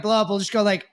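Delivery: normal talking voice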